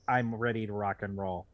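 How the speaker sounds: ordinary talk, nothing marked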